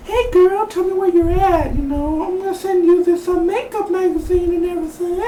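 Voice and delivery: very high mock girly falsetto; imitated girl. Girl phoning Peggy to find her, high mock falsetto. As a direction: put on a squeaky girl's voice, far above your own. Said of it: "that the girl called Peggy".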